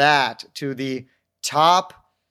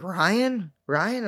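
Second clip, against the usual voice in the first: astonished recognition; 5 semitones higher than usual. Retold or imitated. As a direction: imitate friends who can't believe their eyes. Retold schoolmates stunned to see him.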